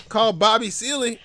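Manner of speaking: usual speaking voice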